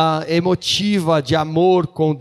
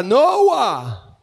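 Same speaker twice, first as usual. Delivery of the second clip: Noah called out high; shouted name; 6 semitones higher, 3 dB louder than usual. Shown as a demonstration. Interpreter shouts for the boy at the road. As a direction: cry Noah as a warning. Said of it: "Ele vai gritar".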